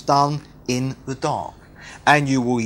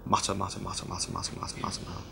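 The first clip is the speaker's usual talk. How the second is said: very low, hushed mumble; barely audible, running murmur; muttering; 8 semitones lower, 15 dB quieter, much faster than usual. Shown as a demonstration. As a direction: mutter it rapidly under your breath. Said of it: "It sounds like the thing it's describing".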